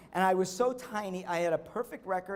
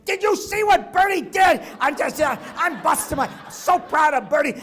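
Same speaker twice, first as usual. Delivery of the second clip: coach screaming with pride; 10 semitones higher, 13 dB louder than usual. Retold or imitated. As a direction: scream at the whole team how proud you are. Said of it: "while he's screaming at the team".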